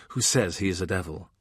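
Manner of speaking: ordinary delivery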